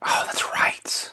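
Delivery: soft breathy whisper, slow; hushed slow remembering. Real, not acted. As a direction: drop to a whisper as it comes back to you. Sudden hushed aside.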